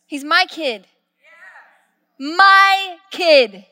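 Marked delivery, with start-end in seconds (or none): 2.2-3.7 emphatic. Real, not acted. Peak of repeated line.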